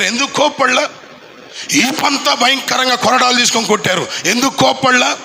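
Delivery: high, driving pulpit voice; fiery Telugu sermon delivery; full-force preaching. Acted. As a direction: preach it out to the whole congregation.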